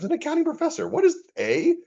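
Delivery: high, baffled question. Retold or imitated. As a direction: squeak out the confusion. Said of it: "I was really confused".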